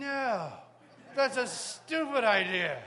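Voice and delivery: loud, slow indignant refusal; loud retort. Retold or imitated. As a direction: bark back a flat no, drawn out. Retorting to God loudly.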